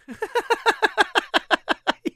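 high-pitched helpless giggle; high giggling fit. Real, not acted. Cracks up at own slip.

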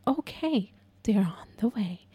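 hushed, near-whispered mock soothing; overly calm dispatcher. Dispatcher saying help is coming, exaggerated calm near-whisper. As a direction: imitate a dispatcher's soft, calm reassurance. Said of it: "they speak like that".

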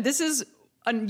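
normal talking voice

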